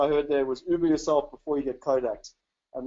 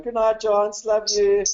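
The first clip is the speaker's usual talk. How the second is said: higher, louder singsong farewell; loving good night; 5 semitones higher, 5 dB louder, slower than usual. Real, not acted. Father signing off to his son over the robot, fond singsong.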